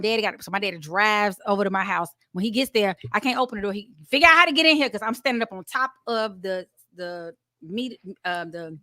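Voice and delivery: ordinary talk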